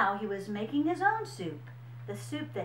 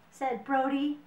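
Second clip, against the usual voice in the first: ordinary delivery, nothing marked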